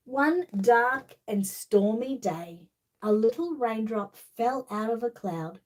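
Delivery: slow, lively storybook reading; reading to children. Acted. Reading The Little Raindrop to child viewers, storybook voice. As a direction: read it like bedtime story time. Reads picture book to children.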